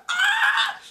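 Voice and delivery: very high squeaky mock fan voice; excited viewer. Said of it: "they're gonna be like".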